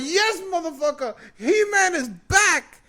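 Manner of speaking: high, loud, elated yell; triumphant cheer. Retold or imitated. Fan cheering his hero's return, triumphant yell. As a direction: stretch it out like a fan celebrating. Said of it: "and I'm going".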